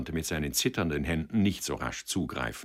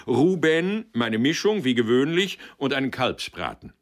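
much louder, higher call across the room; calling the waiter; 9 semitones higher, 7 dB louder than usual. Acted. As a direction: summon the waiter with a booming order. Director calls order to waiter.

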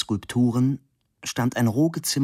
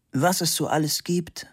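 higher-pitched, mildly sad remark; understated sorrow in a raised pitch; 7 semitones higher than usual. A narrator's reading. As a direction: lament it with a touch of sadness. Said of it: "meinte die Kulp traurig".

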